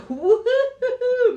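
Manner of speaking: very high, loud, drawn-out whoop with laughter; high laughing whoop. Real, not acted. Speaker reacting as her hand went up, high laughing whoop. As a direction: laugh out a startled, high woo-hoo.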